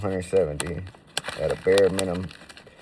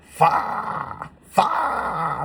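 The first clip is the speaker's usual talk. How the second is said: hyped; 10 semitones higher, 4 dB louder than usual. Real, not acted. Hyping his good coke.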